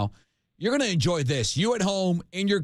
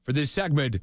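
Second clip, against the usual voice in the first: ordinary talk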